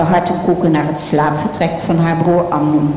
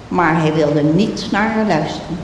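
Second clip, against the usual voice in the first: ordinary delivery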